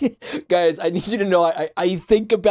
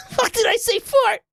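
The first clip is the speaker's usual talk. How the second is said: high-pitched laughing disbelief; real helpless giggles. Real, not acted. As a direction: squeeze the question out through laughter. Cracks up at own slip.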